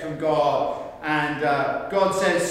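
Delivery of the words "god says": ordinary talk, nothing marked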